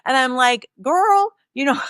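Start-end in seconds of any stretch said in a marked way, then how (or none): 0.8-1.3 put-on high, drawn-out sassy "girl"; sassy retort. Replying to her sister's warning, sassy retort. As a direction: toss it back with mock attitude.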